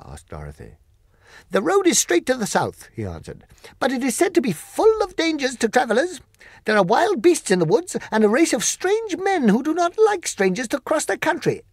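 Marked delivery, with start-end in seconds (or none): 1.5-11.6 high, loud creature voice, firm; polite but final refusal; Monkey King declining, high and loud; Monkey King refusing. Acted.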